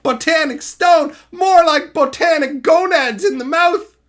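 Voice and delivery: loud mock jeer; hammed-up taunt; mock roast. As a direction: land the punchline loud. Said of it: "Insult complete".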